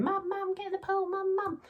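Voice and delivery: high-pitched mock kids pestering mum; whiny child impression with a southern English accent; nagging children. Children pestering mum to get in the pool, high nagging voices. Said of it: "my children have got a southern accent".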